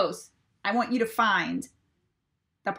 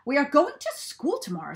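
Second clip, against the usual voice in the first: eager Farmer Brown; 5 semitones higher than usual. A narrator's reading. Farmer announcing school trip to his cows, eager. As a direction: call the news out to the cows. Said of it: "said Farmer Brown".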